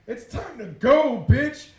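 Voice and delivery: high, loud, drawn-out order; emphatic retold command. Telling himself the trip is starting, loud emphatic command. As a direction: punch it out slowly, rising to a shout. Retelling moment of dread, emphatic.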